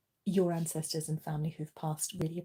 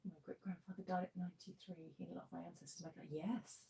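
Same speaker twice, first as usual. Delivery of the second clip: murmuring; 18 dB quieter than usual. Real, not acted. Reading comments under breath.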